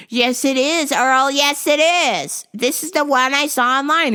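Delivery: high, loud stubborn nag; high old wife voice. Retold or imitated. Maggie arguing with her husband, high stubborn insisting.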